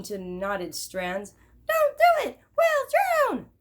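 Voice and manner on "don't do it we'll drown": very high, louder squeaky mouse voices; high, loud mouse panic; panicked mice. Acted. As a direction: squeal like tiny mice facing a bath. Mice panic about the bath.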